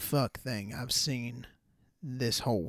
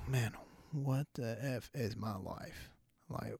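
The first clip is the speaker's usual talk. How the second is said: muttered under breath; 9 dB quieter than usual. Retold or imitated. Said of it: "mumble that under my breath".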